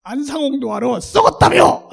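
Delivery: loud, scornful jab; mocking retort. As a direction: taunt the recruiters. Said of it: "진짜 그렇게 말을 했어요". (비꼬며 쏘아붙임)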